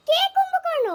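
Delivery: high, loud indignant challenge; outraged shout. Acted. Accused one retorts in acted quarrel. (রাগে চড়া গলায় চিৎকার)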